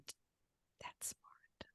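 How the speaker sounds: hushed whisper, barely voiced. Real, not acted. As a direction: whisper it like a secret. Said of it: "Why are you whispering?".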